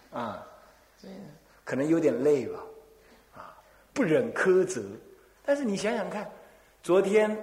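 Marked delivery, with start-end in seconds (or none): none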